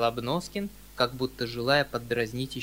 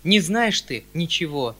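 louder despairing cry; 3 semitones higher, 7 dB louder than usual. A narrator's reading. Uncle cries out to nephew in mild despair. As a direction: wave it away in despair. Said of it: "вскрикнул он".

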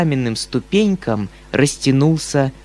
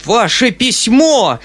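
slow shrill shriek, much higher and louder; 8 semitones higher, 5 dB louder, slower than usual. A narrator's reading. Foma flares up at the uncle, shrieking. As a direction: explode with outrage. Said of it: "взвизгнул Фома".

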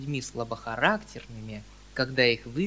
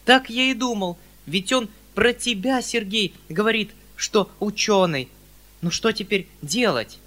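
high, loud worried uncle; flustered, throwing up his hands; fretting uncle; 7 semitones higher, 7 dB louder than usual. Acted. Flustered uncle frets to his nephew, high and loud.

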